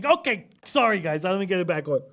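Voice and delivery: sudden energetic overcorrection. Real, not acted. Answers low energy remark.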